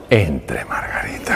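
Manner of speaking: hushed, breathy whisper, voice dropped right down. Retold or imitated. Doctor whispering to his patient to come in, hushed whisper. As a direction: whisper it as if sharing a secret.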